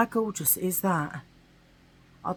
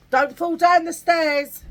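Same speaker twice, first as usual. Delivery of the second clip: called after her, louder and higher; calling out; 9 semitones higher, 8 dB louder, slower than usual. Real, not acted. Vlogger calling to her girlfriend on the stairs, raised voice. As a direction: call a warning down the hall.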